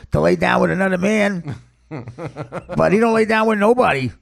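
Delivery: put-on exasperated father at the end of his rope; hammed-up dad airing his family grievances; father imitation. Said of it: "The father talks like this".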